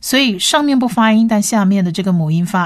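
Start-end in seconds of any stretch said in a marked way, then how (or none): none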